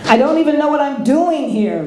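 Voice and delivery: put-on whiny restless farm boy; young Luke. A narrator's reading. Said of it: "Luke said".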